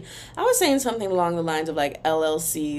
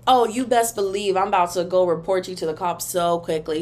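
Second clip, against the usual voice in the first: ordinary talk, nothing marked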